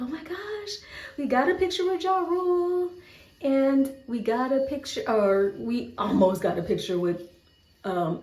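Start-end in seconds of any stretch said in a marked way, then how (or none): 0.0-5.0 high, breathless fan thrill; giddy fans after meeting rappers; excited gush. Retold or imitated. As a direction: squeal it out like starstruck fans. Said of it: "we were so excited".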